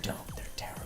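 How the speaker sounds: whispered warning; hushed whisper. Acted. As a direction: whisper it urgently. Whispered banter before intro.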